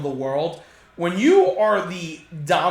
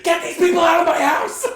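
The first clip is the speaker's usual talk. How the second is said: mock grandma shout, high and loud, laughing through it; imitating grandma yelling; 12 semitones higher, 6 dB louder than usual. Grandma ordering people out, hammed-up laughing shout. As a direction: yell like a fed-up grandma throwing guests out. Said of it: "my grandma would a hundred percent".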